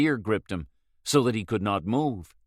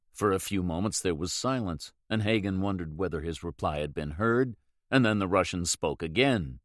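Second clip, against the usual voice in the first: ordinary delivery, nothing marked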